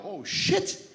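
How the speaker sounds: acted amazement, voice jumping up; astonished. Guy learns the beagle can talk, loudly astonished. Guy stunned by talking dog.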